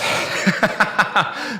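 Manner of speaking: light, winded laughter; short burst of real laughter; breathy laugh. Real, not acted. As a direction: laugh while catching your breath.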